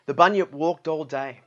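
ordinary delivery, nothing marked

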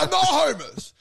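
high, loud, indignant shouting; mock furious passer-by yelling back; offended outburst. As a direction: blow up at the insult. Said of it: "They're like".

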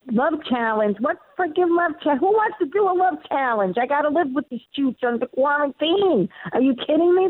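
high, brash Bronx character voice; exasperated Bronx woman scoffing at the idea; Bronx accent. Retold or imitated. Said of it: "make this character Ro from the Bronx".

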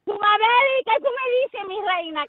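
high, loud sing-song call; excited greeting. Real, not acted. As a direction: call her in with delight. Calls out to caller excitedly. (llamada alegre y aguda)

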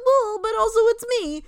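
high, loud imitation of moral judgment; mock moralizing. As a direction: put on a sanctimonious sing-out. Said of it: "being like".

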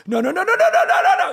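frantic high yelling of no; panicked screaming. Retold or imitated. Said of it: "I'm screaming".

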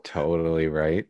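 ordinary talk, nothing marked

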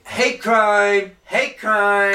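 loud, high mock protest; mock outrage. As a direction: shriek it like fake offence.

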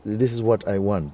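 ordinary talk, nothing marked

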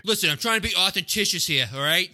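Boston tough guy pleading; mock Boston tough guy. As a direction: play a pushy small-time hustler. Acted Boston tough guy exchange.

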